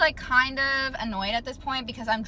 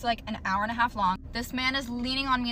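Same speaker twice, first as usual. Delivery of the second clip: ordinary talk, nothing marked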